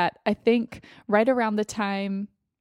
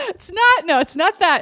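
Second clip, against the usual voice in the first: laughing; 9 semitones higher, 6 dB louder than usual. Real, not acted. Laughing protest.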